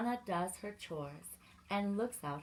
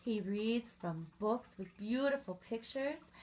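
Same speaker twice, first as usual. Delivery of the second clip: ordinary delivery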